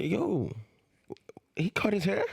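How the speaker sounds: confused surprise. Retold or imitated. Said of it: "you're looking at him like".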